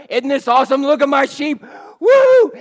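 loud, high, whooping celebration; joyful shout. Acted. Shepherd cheering to neighbours over found sheep, loud joyful whoop. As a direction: shout it out like you found your lost sheep.

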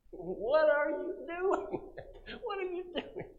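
high-pitched, drawn-out wail to the Lord; mock dismayed plea. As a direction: cry out to God in comic disbelief, voice way up. Mock plea to God, laughing.

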